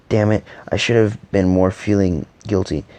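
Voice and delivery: ordinary delivery, nothing marked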